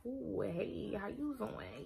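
soft, quiet flirty greeting; mock flirt, hushed and sly; flirty hello. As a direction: coo a hello at someone cute. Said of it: "just be like".

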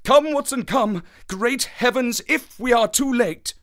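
high, loud, frantic urging; urgent cry. A narrator's reading. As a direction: cry out to rally a companion to run. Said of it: "cried Holmes".

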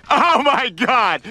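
ordinary delivery

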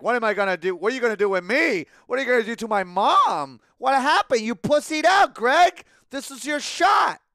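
increasingly loud, incredulous goading; mock confrontation. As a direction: escalate into hammed-up outrage. Acts out confronting the neighbour.

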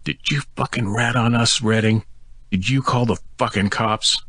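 snarling, high-pitched furious accusation; angry accusation. A narrator's reading. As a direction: spit it out at him, enraged. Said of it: "bared his teeth at me".

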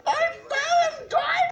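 high, slow despairing shout. Real, not acted. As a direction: wail it out loud. Dies in game.

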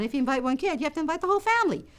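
plain reading voice

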